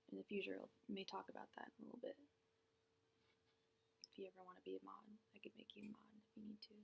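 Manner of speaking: fast hushed whisper. Real, not acted. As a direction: let the offer slip out in a quick whisper. Quiet aside offering mod role.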